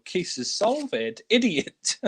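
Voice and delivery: high-pitched mock scorn; mocking echo. Exasperated boss once Clouseau has gone, high mocking imitation.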